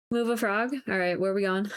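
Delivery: normal talking voice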